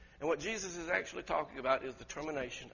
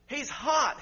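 ordinary delivery

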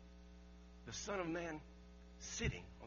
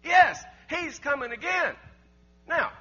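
much louder and higher, hammering each phrase; preaching at full force; 8 semitones higher, 13 dB louder than usual. Acted. Preacher proclaiming the second coming to the congregation, fervent shout. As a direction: build the sermon to a shouting climax.

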